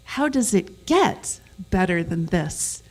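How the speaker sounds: slow sentence leaning on "get"; stress on get. Shown as a demonstration. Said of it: "where you emphasize each word in a sentence".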